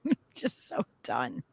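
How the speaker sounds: whispered, laughing through it. Real, not acted. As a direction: breathe it out, spent and laughing. Exhausted whispered venting.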